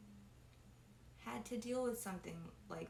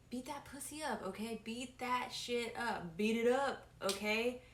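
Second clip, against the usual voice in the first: emphatic; 3 semitones higher, 4 dB louder than usual. Real, not acted. Emphatic explanation of lyric.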